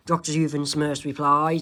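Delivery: usual speaking voice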